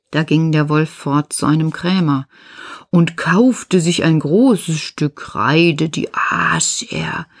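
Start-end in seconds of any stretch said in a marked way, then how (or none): none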